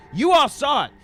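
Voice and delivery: indignant ranting crank. Acted. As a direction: cry out like a persecuted street preacher. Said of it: "and he says".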